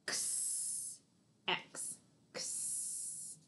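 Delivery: unvoiced ks hiss for X; X sound. Shown as a demonstration. Teacher sounding out X for kids. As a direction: breathe out X as a soft ks. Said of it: "taking a K sound and an S sound".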